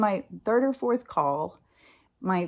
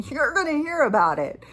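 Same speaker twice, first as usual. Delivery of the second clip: high, loud retold rant; angry insistence; 8 semitones higher, 5 dB louder than usual. Angry caller venting at the agent anyway, loud insistence. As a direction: insist loudly, pitched up. Retells furious reply to agent.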